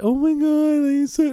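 very high squealing mock baby talk; gushing baby talk. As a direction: squeal it like someone cooing at a dog. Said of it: "I'm not that".

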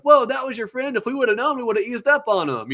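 mock surprise. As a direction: gasp it out like you truly didn't know. Players learn it was his friend.

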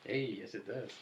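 usual speaking voice

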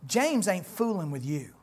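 usual speaking voice

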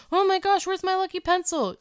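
high, frantic panic over a lost pencil; shrill-edged freakout, voice jumping up; panicked. Retold or imitated. Panicked teen searching for pencil.

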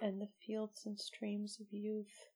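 soft, hushed, choked-up finish; barely voiced, overcome; moved, near tears. Real, not acted. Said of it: "Bro, I am not okay".

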